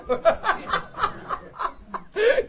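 long high laugh building up; high rising laugh. Real, not acted. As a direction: laugh out at the absurdity. Laughing at grumpy morning.

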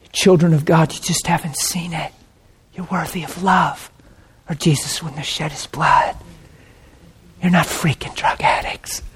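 hushed, near-whispered comfort; gentle whisper, with long pauses; tender reassurance. Retold or imitated. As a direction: speak very softly to someone weeping. Retells tenderly consoling the crying man.

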